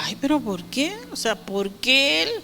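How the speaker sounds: whiny, reluctant "why me", lightly played; mock complaint. Preacher mocking her younger self grumbling, whiny. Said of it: "yo decía".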